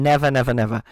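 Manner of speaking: ordinary talk, nothing marked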